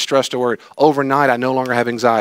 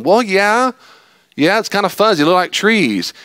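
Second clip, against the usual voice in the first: higher, louder, half-sure answer; the squinting man, unsure of what he sees; lightly voiced healed man, higher and unsure; 8 semitones higher, 4 dB louder than usual. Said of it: "I can hear the guy going".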